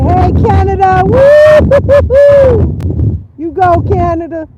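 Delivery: high, loud whooping hello; excited shout-out. Real, not acted. Greeting viewers from Canada with an excited whoop. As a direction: holler a greeting, then whoop high and long.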